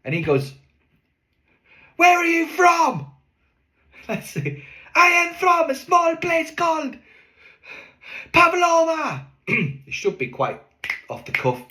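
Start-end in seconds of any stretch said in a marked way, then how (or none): 2.0-9.3 much higher, louder, slow yelled exchange; shouting over no mic. Retold or imitated. Partners shout the accent bit at a silent audience. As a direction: bellow the bit with no microphone. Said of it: "when you're shouting it".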